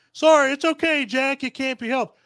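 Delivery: mocking Dorothy. Mocking Dorothy's excuse to Jack, in a high mock-sweet voice. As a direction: squeak it out like a prissy little girl. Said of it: "So saying".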